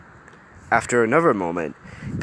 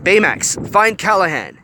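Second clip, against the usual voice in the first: loud command; 10 semitones higher, 5 dB louder than usual. A narrator's reading. Hiro commanding robot.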